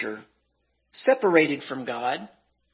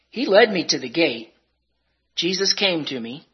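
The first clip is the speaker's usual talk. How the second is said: ordinary talk, nothing marked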